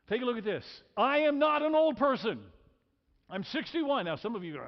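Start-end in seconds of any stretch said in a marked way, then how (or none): 1.0-2.5 loud, high and emphatic; emphatic declaration. Real, not acted. Preacher to the congregation, loud emphatic declaration. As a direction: declare it boldly to the room.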